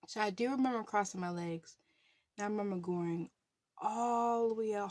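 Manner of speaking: usual speaking voice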